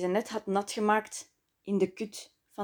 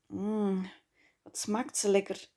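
yearning murmur; 5 dB quieter than usual. A narrator's reading. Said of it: "smachtte ze naar meer".

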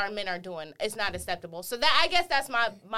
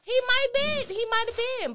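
much higher, playful pitch; high mock voice; 13 semitones higher, faster than usual. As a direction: squeak it out teasingly.

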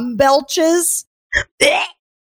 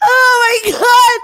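laughing; 12 semitones higher, 5 dB louder, slower than usual. Real, not acted. After hard laughter.

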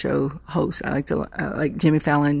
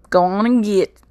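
louder, higher imitated southern twang; southern accent; 5 semitones higher, 5 dB louder than usual. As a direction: belt out a southern catchphrase. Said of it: "I'm saying it with my southern accent".

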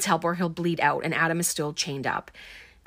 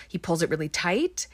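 ordinary talk, nothing marked

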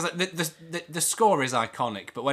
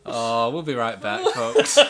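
laughing; 4 semitones lower than usual. Real, not acted. Mock presenter while laughing.

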